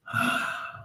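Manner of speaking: long breathy exhale; defeated sigh; heavy sigh. Acted. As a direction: sigh as you slump into a chair. Slumps into the armchair.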